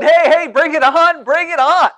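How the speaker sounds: loud, high whooping crowd cheer; excited cheering. Pretend. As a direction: cheer along at a parade, full voice. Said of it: "I'd be up there cheering".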